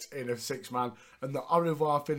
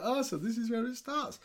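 high knowing voice; 8 semitones higher than usual. Retold or imitated. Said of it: "I'm like".

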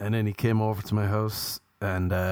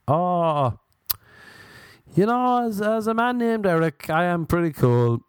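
mock cool guy; 8 semitones higher than usual. Mock cool self-reply as Eric.

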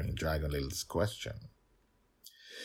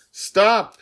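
much higher, very loud shout; 15 dB louder than usual. Acted. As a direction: cry out to halt the count. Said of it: "Dragon Little shouted".

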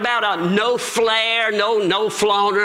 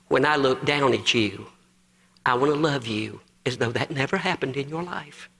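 low, softened voice of a forgiving Lord; tender reassurance; 6 semitones lower, 3 dB quieter than usual. Acted. The Lord answers the preacher about forgiveness, tenderly. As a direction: drop low and gently reassure.

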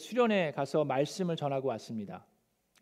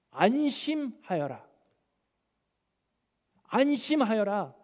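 gentle reassurance; 4 semitones higher, slower than usual. Acted. Jesus comforting the healed woman.

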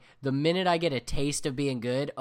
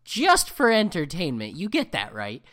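exasperated rant; 3 semitones higher, 7 dB louder than usual. Retold or imitated. Arguing with friend Matt about games.

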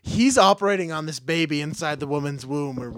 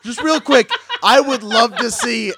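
higher and louder, laughing through the words; 5 semitones higher, 6 dB louder than usual. Real, not acted. Picturing blind teams playing basketball, laughing. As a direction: giggle while getting the words out.

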